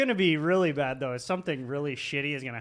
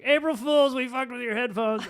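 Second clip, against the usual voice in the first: high, loud mock-kid announcement; gleeful prank reveal; 8 semitones higher, 4 dB louder than usual. Kids coming into the studio, mock gleeful reveal.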